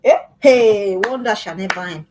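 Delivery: incredulous parent, with a loud "hey"; mocking disbelief. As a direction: scoff in mock amazement at the child. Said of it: "Don't talk like that".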